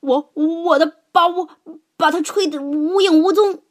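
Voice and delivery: loud, high blustering shout; elephant bellowing. A narrator's reading. Elephant bellowing his brag at the others, loud. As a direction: trumpet your boast out loud. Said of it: "大象大吼道".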